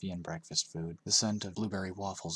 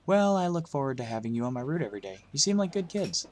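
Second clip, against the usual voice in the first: friendly bus driver; 6 semitones higher, 5 dB louder than usual. A narrator's reading. Narrator voices bus driver.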